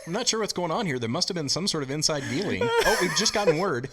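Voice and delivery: playful breaking-news commentary; mock commentator. Guest reports inside dealing at the game, mock commentator voice.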